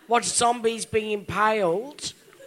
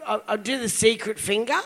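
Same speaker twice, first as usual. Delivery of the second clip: normal talking voice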